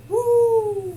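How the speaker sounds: high, loud woo; loud hype cheer. Real, not acted. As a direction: belt out a high celebratory woo.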